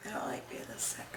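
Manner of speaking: weak sick whisper. Real, not acted. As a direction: whisper it, feeling ill. Sick, talking to herself.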